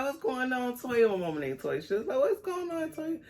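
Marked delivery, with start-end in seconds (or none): 0.0-1.1 grandma's sing-song high greeting, pitched well up; lightly voiced grandma, high and curious. 2.2-3.2 grandma's sing-song high greeting, pitched well up; lightly voiced grandma, high and curious. Grandma repeats greeting higher.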